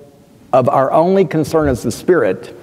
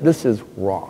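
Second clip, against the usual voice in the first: quiet; 6 semitones lower, 6 dB quieter than usual. Real, not acted.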